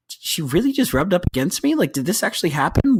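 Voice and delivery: high-pitched comic disbelief; high, indignant can-you-believe-this retelling; incredulous. Retold or imitated. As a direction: act out scandalized disbelief, pitched well up. Retold disbelief at being rubbed against.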